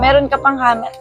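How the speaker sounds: ordinary delivery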